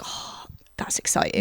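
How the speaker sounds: whispered, flat mock excitement; a hushed, dry "exciting"; deadpan whisper. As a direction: breathe it out sarcastically. Sarcastic whispered reaction to alliance.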